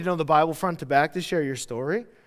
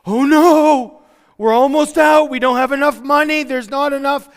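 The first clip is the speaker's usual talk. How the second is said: higher, louder hammed-up fretting; mock panic; 6 semitones higher, 7 dB louder than usual. Worried believer fretting over running out of money, mock panic.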